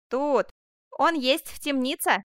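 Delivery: loud servant's announcement; shouted reply. A narrator's reading. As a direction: call out the answer firmly. Same servant calling out his answer.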